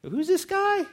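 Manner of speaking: very high-pitched incredulous question; puzzled reaction. Retold or imitated. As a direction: squeak out your doubt about the stranger. Puzzled fishermen react to stranger.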